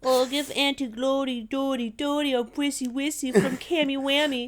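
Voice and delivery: high, silly rhyming coo; cutesy high nonsense-talk; baby talk. Pretend. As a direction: gush it in mock baby talk. Baby-talk message for auntie.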